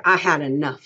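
loud, mildly cold firmness. A narrator's reading. Crooks confronts the woman in his room, cold. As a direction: put your foot down with a touch of coldness. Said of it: "he said coldly".

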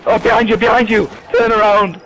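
loud, quick alarm call; urgent warning shout. Real, not acted. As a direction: yell a warning to a teammate. Warning a teammate in the game.

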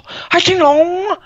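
high, drawn-out fake Chinese accent; mock Chinese accent. Friend asks him to do Chinese, mock Chinese accent. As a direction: caricature Chinese in a high sing-song. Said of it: "Do Chinese".

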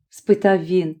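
plain reading voice

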